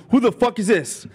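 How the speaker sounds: high-pitched imitated outrage; mock angry demand. Angry boyfriend type to unknown caller, mock outraged yell. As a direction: imitate an irate man demanding answers.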